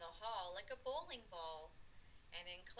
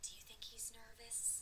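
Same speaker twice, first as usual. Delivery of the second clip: soft whisper to the listener; whispered aside; 7 dB quieter than usual. Real, not acted. Reader asks the children about Froggy, whispering. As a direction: confide it in a whisper.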